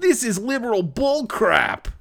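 high-pitched, hammed-up indignation; mock outrage. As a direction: protest in exaggerated disgust. Acting out character's outraged reaction.

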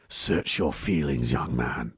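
mock sinister villain intoning slowly; menacing movie villain. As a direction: loom over him like a dark lord. Movie line quoted in character.

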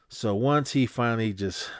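ordinary talk

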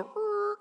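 high imitated tire screech; screeching brakes. Dad pulling the truck over, screeched brake noise.

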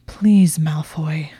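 a forced plea, pushed out through gritted teeth then dropping to a whisper; grudging whisper through gritted teeth. Acted. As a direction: plead to a rival you resent, teeth gritted. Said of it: "she said through gritted teeth".

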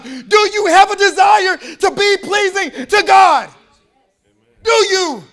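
loud, high shouting preacher, slowing on the questions; full-force preaching. Acted. Preacher challenging the congregation, shouted high questions.

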